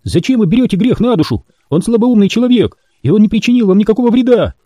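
high, rising protest; indignant girl. A narrator's reading. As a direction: defend the helpless man. Temple pleads angrily.

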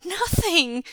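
put-on high, loud child's denial; panicked yell back. A narrator's reading. Son yells down to father after a crash, panicked. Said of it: "Jingyi yelled back, a note of panic".

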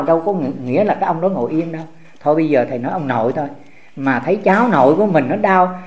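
ordinary talk

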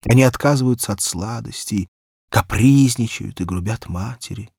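usual speaking voice

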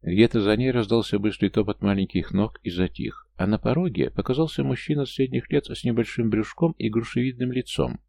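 ordinary delivery

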